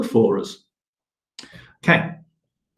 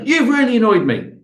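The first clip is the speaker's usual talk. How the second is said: mock accusation; 9 semitones higher, 4 dB louder than usual. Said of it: "go to that person who annoyed you and say".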